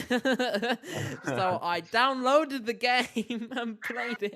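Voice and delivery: laughing through the words, high; giggling while confessing. Real, not acted. Laughing while telling porn game.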